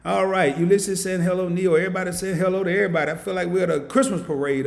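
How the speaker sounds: loud, high, fast hype greeting the chat; hyped greeting. Real, not acted. Host greeting the live chat, loud high hype. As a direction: shout out the whole chat like a party.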